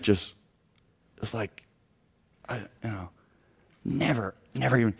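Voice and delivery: soft, halting mock bewilderment; imitating someone stunned nearly speechless; deer in the headlights. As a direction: stammer faintly, as if caught off guard. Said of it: "this deer in the headlight look".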